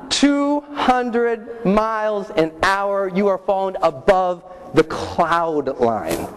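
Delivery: very slow, stretched-out words; slow, dramatic. Retold or imitated. Telling the audience about falling above clouds, drawn-out slow. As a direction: draw each word out for drama.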